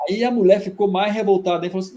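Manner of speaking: usual speaking voice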